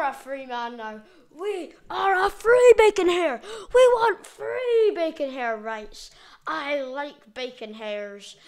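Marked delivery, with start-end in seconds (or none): 1.3-6.1 loud, high protest chant; rally the crowd, hammed-up; high shouted slogan; mock rally cry. Chanting for bacon hair rights.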